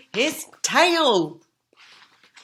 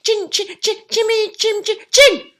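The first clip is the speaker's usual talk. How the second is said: high squeaky frightened voice; trembling piggy; 8 dB louder than usual. Acted. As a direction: quaver it out, scared pig. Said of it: "trembled Boris".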